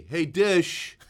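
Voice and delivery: loud high shout at the dish; calling out loudly. Pretend. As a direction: bark it like a command. Yelling at the satellite dish.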